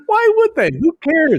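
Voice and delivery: high, loud mock outrage; jokey high-pitched protest; mock indignant. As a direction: squeal it in fake indignation. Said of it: "mock indignation".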